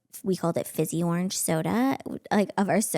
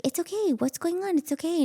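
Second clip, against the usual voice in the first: much higher, gentle soothing; soothing husband; 10 semitones higher than usual. Retold or imitated. Husband soothing his frightened wife in bed, gentle and higher. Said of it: "he's like".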